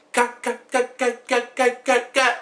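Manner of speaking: a hint of a stuck lopsided mouth; mock stroke speech; stuck stutter. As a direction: repeat "got" like a skipping record. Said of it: "so she talking like this".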